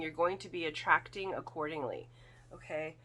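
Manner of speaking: usual speaking voice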